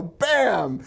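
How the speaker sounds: high, punchy bam; mock bam. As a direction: pop it out like a blast. Acted explosion sound.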